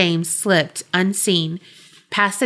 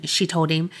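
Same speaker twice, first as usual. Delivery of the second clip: plain reading voice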